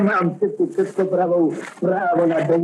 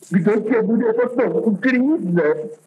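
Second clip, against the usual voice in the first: slow, higher, changed character voice; other character's voice; 7 semitones higher, slower than usual. Acted. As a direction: switch to the other figure and drag it out. Victim voiced in the sketch.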